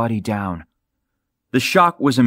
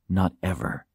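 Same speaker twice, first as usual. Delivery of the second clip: a quiet, softer, bitter inner thought; 4 semitones lower, 8 dB quieter than usual. Acted. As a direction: drop it low in volume, bitterness held in. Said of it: "he thought bitterly".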